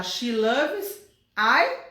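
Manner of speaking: slow questioning She loves I with I rising; wrong form, drawn out. Shown as a demonstration. Teacher offering the wrong option slowly to her students. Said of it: "eu tenho que falar".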